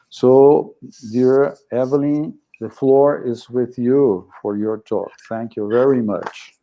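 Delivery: normal talking voice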